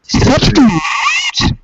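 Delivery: high, slow disbelieving question; incredulous. Real, not acted. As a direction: stretch it out in disbelief. Mocking disbelief at sixty.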